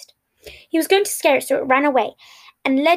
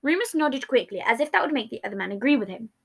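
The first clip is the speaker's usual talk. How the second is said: ordinary delivery, nothing marked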